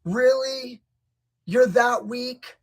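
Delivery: high, slow scornful jab; mocking disbelief. Real, not acted. Coach mocking the heavyweight, high and scornful. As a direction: needle him for being weak. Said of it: "I'm gonna sound a little mean".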